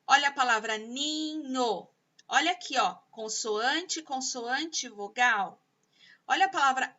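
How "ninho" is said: "ninho" split into two drawn-out syllables; stretched, separated beats on "ninho". Shown as a demonstration. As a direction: sound out "ninho" slowly, NI, then NHO. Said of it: "vários tipos de sílabas".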